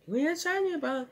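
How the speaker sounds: indignant character. Retold or imitated. Kid voicing a cartoon character, quick high protest. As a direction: rattle it off high like an offended cartoon critter. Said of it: "he was saying".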